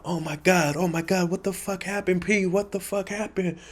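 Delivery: put-on high, frantic voice of a panicked girl. Girls seeing him collapse, high frantic panic. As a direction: shriek it like a friend seeing blood.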